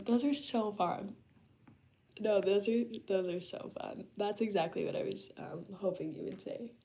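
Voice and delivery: usual speaking voice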